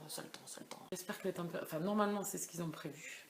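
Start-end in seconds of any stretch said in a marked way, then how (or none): 0.1-0.9 soft repeated whisper. Real, not acted. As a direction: whisper it to yourself. Repeats the phrase, then whispers it.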